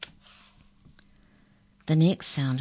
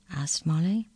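plain reading voice

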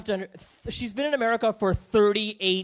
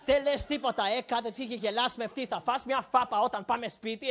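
imitated scolding mom; 5 dB quieter than usual. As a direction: scold in a rapid undertone. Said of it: "my mom is just like".

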